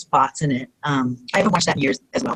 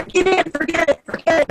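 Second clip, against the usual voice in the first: high-pitched frantic urging; partner yelling; 11 semitones higher than usual. Retold or imitated. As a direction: hurry her, high and urgent. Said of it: "she pulled my hair".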